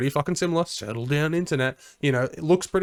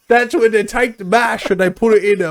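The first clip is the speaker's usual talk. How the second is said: loud, high hammed-up Australian accent; mock Australian accent; 7 semitones higher, 9 dB louder than usual. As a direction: play a rowdy Aussie bloke, loud and high. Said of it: "we are in Australia".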